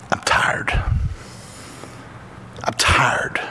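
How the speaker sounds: put-on breathy whisper of a worn-out old man; weary whisper. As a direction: let it fade into a tired whisper. Dying grandpa quoted.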